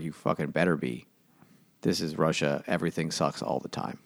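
deep Russian priest. Retold or imitated. Priest scolding villagers, in a low mock Russian voice. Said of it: "comically deep Russian voice".

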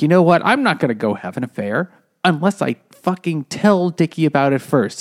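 defiant retort. Retold or imitated. Wife's defiant retold outburst.

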